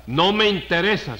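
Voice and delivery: exasperated dismissal. Acted. Judge cuts off a squabbling litigant, exasperated. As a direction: shut down the argument, voice rising in exasperation.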